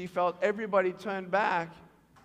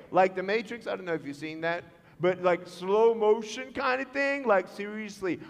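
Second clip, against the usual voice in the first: normal talking voice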